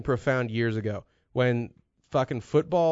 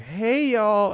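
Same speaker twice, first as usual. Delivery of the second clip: higher, lightly imitated Southern greeting; Southern accent; 6 semitones higher than usual. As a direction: imitate a Southern girl's drawl. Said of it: "and it sounds like".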